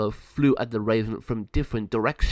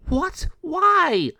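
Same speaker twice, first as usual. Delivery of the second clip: very high, loud disbelief; dismayed; 6 dB louder than usual. Acted. Girl told she must go alone, high and loud. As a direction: blurt it in shock.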